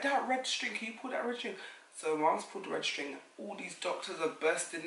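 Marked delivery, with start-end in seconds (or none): 0.0-1.5 high nurse voice. Retold or imitated. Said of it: "She was like".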